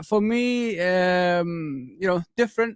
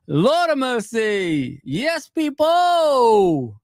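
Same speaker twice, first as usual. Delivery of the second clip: slow, drawn-out high cry of joy; overjoyed; 4 semitones higher, 4 dB louder, much slower than usual. Real, not acted. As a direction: stretch it out in pure delight. Overjoyed at transfer news.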